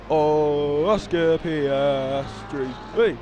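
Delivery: slow, low, stretched-out words; drawn-out. Real, not acted. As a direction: draw each syllable right out. Drawn-out aside while commentating.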